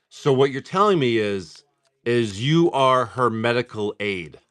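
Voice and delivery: slow, measured retold question; deliberate, composed manager. Manager weighing his words to the husband, slow and deliberate. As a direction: spell it out slowly, as if gathering your thoughts. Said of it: "visibly took a deep breath, gathered his thoughts".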